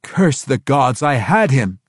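a hint of a fuming drow noble. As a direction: spit out his frustration. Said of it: "Tiago fumed".

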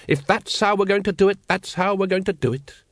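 a hint of a fussy cult leader scolding; pompous Grand Master. A narrator's reading. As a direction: scold the brethren like an irritated chief. Grand Master voiced in story.

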